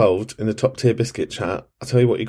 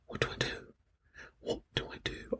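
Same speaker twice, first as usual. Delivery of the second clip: hushed, lost whisper; panicked whisper; 19 dB quieter, faster than usual. Retold or imitated. Host acting out her panic when apps went down, whispered. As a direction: whisper it like you're stranded. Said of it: "I was like".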